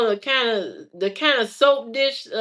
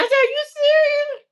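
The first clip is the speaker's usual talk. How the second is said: very high, louder incredulous question; can't believe the gift; disbelief; 5 dB louder than usual. Retold or imitated. Her disbelief at dad's gift.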